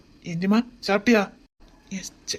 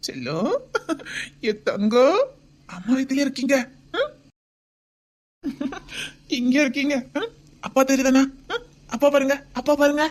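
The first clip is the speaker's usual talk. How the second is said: put-on baby talk; 4 semitones higher than usual. Father talks to baby in womb.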